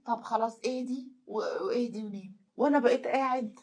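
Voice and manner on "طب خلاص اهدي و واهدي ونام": weary soothing. Retold or imitated. Husband settling his wife to sleep, low and soothing. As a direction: settle someone back to sleep quietly.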